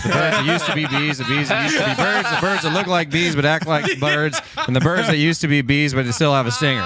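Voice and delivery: hammed-up Australian comic voice, speeding through the list with giggles; Australian comedian impression. Retelling a comedian's bit to friends in an Australian accent. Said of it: "Australian accent".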